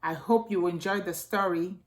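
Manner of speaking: plain reading voice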